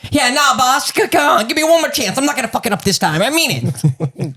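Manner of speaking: high, fast whiny junkie; crackhead pleading. Acted. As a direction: plead like a twitchy weasel. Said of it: "We'll call him the Weasel".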